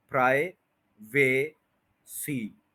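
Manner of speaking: प्राइवेसी split slowly, full "vay"; common mispronunciation. Shown as a demonstration. Teacher repeats the error to avoid, lengthened vowel. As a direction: stretch the middle of प्राइवेसी to "vay". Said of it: "ये लंबा नहीं करना".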